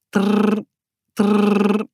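rolled-r buzzing imitation; imitated alarm trill; watch alarm. A narrator's reading. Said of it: "deed haar horloge".

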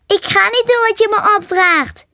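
high, squeaky refusal; defiant squirrel. A narrator's reading. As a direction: dig in as a stubborn little animal.

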